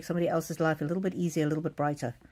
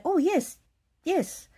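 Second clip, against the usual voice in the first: much higher, happy little yes from the mother-in-law; eager elderly reply; 11 semitones higher, much slower than usual. Retold or imitated. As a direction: answer brightly like a relieved old lady. Voicing mother-in-law's simple reply.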